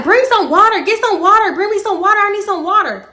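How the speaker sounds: high, loud, urgent calls for water; shouting from the car for water; frantic yelling. Retold or imitated. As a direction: yell it out, panicked and pleading.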